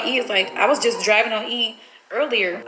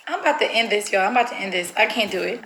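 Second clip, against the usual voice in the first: ordinary talk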